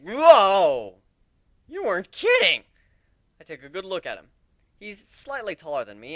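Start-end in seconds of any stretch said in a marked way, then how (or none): 0.0-2.6 loud, high gasping whoa; shocked out of the sentence; astonished exclamation. Acted. Narrator seeing Aoi transformed, loud astonishment. Seeing Aoi's human form.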